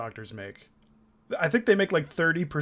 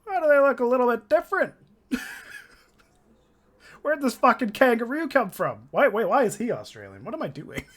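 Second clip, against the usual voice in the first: confused farmer; 9 semitones higher than usual. Pretend. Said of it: "being like".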